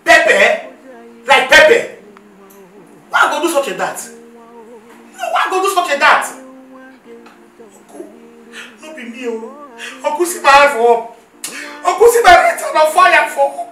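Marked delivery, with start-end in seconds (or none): 0.0-6.2 loud, outraged question; shocked disbelief. Acted. Niece accused of pouring pepper, loud shocked denial. 10.0-13.7 loud, desperate swearing; pleading loud oath. Acted. Niece swearing before uncle she is innocent, loud plea.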